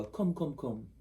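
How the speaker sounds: light Jamaican come call; a hint of Jamaican beckoning; Jamaican accent. Imitating Jamaicans in Florida, light Jamaican accent. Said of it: "all these Jamaicans and they go".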